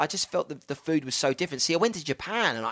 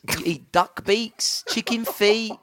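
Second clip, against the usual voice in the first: incredulous listing; 8 semitones higher, 4 dB louder, much slower than usual. Real, not acted. Listing shocking airport foods.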